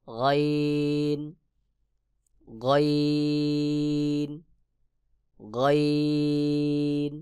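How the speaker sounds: গাইন held very long from the top of the throat; drawn-out letter demo. Shown as a demonstration. Teacher showing students the throat letter গাইন. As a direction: stretch out গাইন slowly, Quran style. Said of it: "যেমন".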